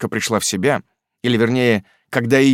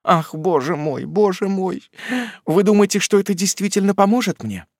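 babbling helplessly; 9 semitones higher than usual. A narrator's reading. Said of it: "пролепетала матушка".

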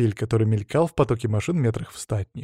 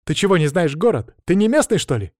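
high, louder exasperated challenge; angry questioning; 6 semitones higher, 3 dB louder than usual. A narrator's reading. Маша berates driver who took a wrong turn, angry. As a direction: press the questions with rising pitch. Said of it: "разозлилась Маша".

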